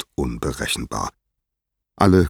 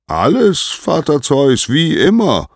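very high, loud singsong sky voice; high-pitched, ringing herald's reply; Helios calling down; 11 semitones higher, 6 dB louder than usual. A narrator's reading.

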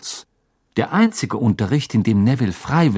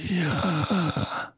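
soft, higher, stretched reply; quiet drawn-out prompt; 6 semitones higher, 6 dB quieter than usual. A narrator's reading. Teacher calling on a raised hand, quiet drawn-out prompt. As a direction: let it creak out softly. Moody calls on Hermine.

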